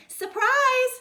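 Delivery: high, loud cheerful cry; spring the surprise gleefully; surprise shout. A narrator's reading. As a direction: parents surprising their daughter with a present. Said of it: "said Mama and Papa".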